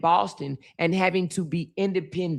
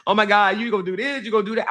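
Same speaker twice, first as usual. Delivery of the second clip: slightly raised, quick fretting; retold alarm; 3 semitones higher, much faster than usual. Mother retelling her fear for her daughter, mildly alarmed. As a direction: fret in mock alarm, question after question. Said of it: "I was so scared".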